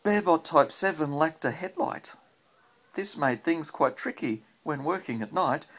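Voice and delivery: rhythmic storybook read-aloud; reading to a child. Acted. Parent reads rhyming robot book to child, lilting read-aloud. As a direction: read the rhyme to a little one at bedtime.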